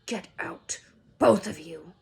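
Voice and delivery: hoarse, half-whispered order; croaked. Acted. Uncle croaking at both boys to leave, hoarse. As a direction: rasp out the order in shock. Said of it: "croaked Uncle Vernon".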